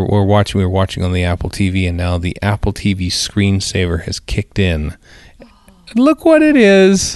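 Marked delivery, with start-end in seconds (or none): 5.4-7.2 high, drawn-out singsong lead-in; slow, high-pitched playful ta-da; excited mock reveal. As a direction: playfully announce it on a held high note.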